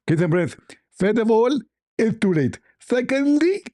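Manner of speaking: high-pitched lisping caricature, listing threats; Zakir Naik. Acted. Caller counts threats at his rival, high mock impression. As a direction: squeal each count upward, gloating. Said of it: "Zakir Naik, don't count".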